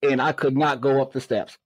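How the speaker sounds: normal talking voice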